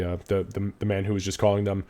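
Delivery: normal talking voice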